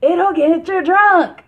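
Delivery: high, loud, slowed hammed-up pitchman voice; a mock commercial voice while laughing; Dave Chappelle impression. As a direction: ham up a beer ad pitch. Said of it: "And he was like".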